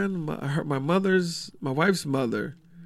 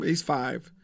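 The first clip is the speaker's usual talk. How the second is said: ordinary talk, nothing marked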